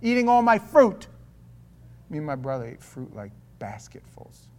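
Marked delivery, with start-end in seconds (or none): 0.0-1.1 loud scolding grandmother; a scolding Yiddish grandmother calling out. Retold or imitated. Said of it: "She used to call my mother and say".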